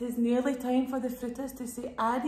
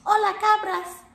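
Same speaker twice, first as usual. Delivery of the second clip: loud, high hola cabras; shout; 9 semitones higher, 6 dB louder than usual. Acted. Pili shouts hello to the goats, loud and high. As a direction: call out to the goats across the field. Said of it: "Pili shouts".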